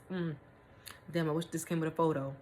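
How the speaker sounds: usual speaking voice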